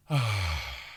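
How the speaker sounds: big open sigh on ah; dramatic sigh. Shown as a demonstration. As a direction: let out a nice big sigh. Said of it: "and a nice big sigh".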